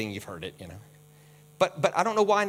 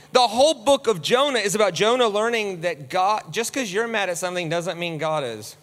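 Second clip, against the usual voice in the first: normal talking voice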